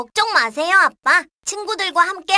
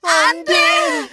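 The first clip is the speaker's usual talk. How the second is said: shout; 6 semitones higher, 4 dB louder, much slower than usual. Acted. Mother threatened.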